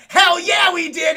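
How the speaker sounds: excited shout. Acted. Lexa shouts back to the predator, thrilled they are friends. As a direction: yell it like a triumphant yes.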